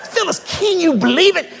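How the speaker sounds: high, amazed call to his wife, laughing; Keith's high voice. Retold or imitated. Husband amazed at the food, calls his wife in a high voice. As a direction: squeal it in delighted disbelief. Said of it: "he's got that high voice".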